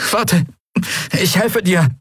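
hoch und gehetzt; besorgt. A narrator's reading. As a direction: schnell helfen wollen. Miko will Xea helfen. (besorgter Ruf)

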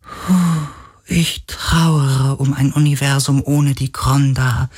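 high, tearful spirit voice; grieving spirit. A narrator's reading. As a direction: mourn a lost universe, near tears. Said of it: "seine Liebe und sein Kummer waren echt".

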